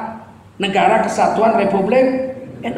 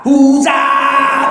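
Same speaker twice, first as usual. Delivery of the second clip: Hujan shouted loud in blunt Batak style; Hujan, louder and blunt, Batak manner; Minang song Batak style; 5 semitones higher, 6 dB louder than usual. Shown as a demonstration. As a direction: bark Hujan like a Batak singer. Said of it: "Coba lihat Minang gaya Batak".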